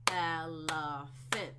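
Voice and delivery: Elephant said slowly, each syllable apart. Shown as a demonstration. Teacher showing a class the syllables, slow and spaced. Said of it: "that's three syllables".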